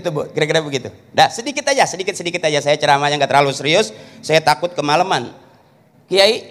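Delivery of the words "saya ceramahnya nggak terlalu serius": ordinary talk, nothing marked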